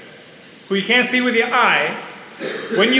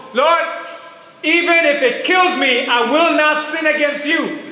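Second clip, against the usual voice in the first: raised, pitched-up earnest vow to God; fervent pleading prayer; 6 semitones higher than usual. Shown as a demonstration. Preacher shows the congregation how to vow to the Lord, fervent high plea. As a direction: cry out the promise with everything you have. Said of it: "When I say it like that".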